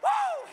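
high joyful shout of woo; exultant whoop. Real, not acted. Preacher whooping to the church, ecstatic. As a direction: let out a soaring whoop of praise.

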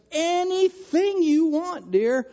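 high, loud, drawn-out eager reply; suddenly all too willing; eager sing-song reply. Retold or imitated. Husband rushing downstairs to his wife, eager and sing-song.

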